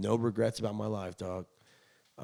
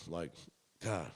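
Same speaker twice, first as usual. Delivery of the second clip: ordinary talk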